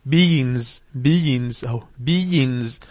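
BEINGS with the g melted into a nasal hum. Shown as a demonstration. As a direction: demonstrate "beings" slowly with no hard g. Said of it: "حرف ال G وال I N بقى كله بقى غنة". (beings بغنة من غير جيم)

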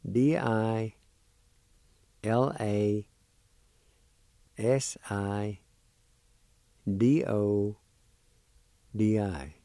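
slow, careful letter-by-letter spelling; spelled out letter by letter. Shown as a demonstration. Teacher spelling the word for students, letter by letter. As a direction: say each letter pair clearly, pausing. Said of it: "The second part is spelled like this".

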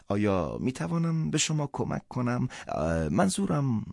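gently pressing woman's voice; lightly voiced woman. Woman's voice, held figure.

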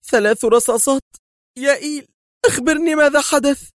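shocked woman's gasping cry; gasping alarm. A narrator's reading. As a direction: plead in shock for answers. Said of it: "شهقت هاتفة".

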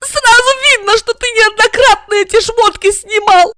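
high, loud tearful shout. A narrator's reading. Regina hurls it at Igor through tears, high and loud. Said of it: "Со слезами крикнула Регина".

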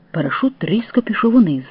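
plain reading voice

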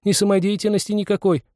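put-on high, hurt girlish complaint; plaintive and put out; aggrieved girl. A narrator's reading. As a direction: grumble in an offended girl's voice. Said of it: "продолжал уже обиженно второй голос".

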